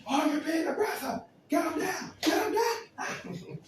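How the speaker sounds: very high, loud yelling; hammed-up panicked command, stretched at the end; mock alarmed shouting. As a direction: shout it way up high, as if ordering a takedown. Acted alarm over an aggressive person.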